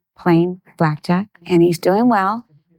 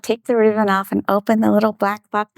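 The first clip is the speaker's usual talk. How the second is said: ordinary delivery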